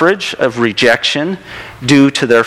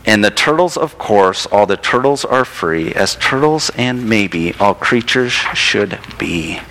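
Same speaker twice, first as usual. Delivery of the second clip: lower, slowing picture-book close; storybook ending; 4 semitones lower than usual. A narrator's reading. Ends the story reading.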